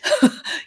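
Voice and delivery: airy high-pitched giggle; high breathless laugh. Real, not acted. Laughing at viewers who went too far. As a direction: laugh it out lightly on a high note.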